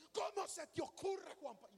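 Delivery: a hint of an exasperated wife; scolding wife. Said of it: "me pegó un regaño".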